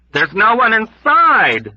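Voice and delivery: high, loud, slow yell; loud startling yell; sudden yell. Acted. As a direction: yell it out unexpectedly. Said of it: "Dunbar yelled out at him unexpectedly".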